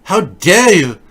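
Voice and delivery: hammed-up indignant shriek; mock furious Greta. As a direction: shriek it like a wronged child. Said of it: "Everything she says is angry".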